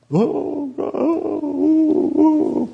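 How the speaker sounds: high drawn-out mock wail; hammed-up woeful oh; mock whine. Mock sighing woe.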